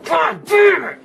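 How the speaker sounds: very high raging yell; real outburst of fury; enraged scream. Real, not acted. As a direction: scream it out in frustration. Hit again in game.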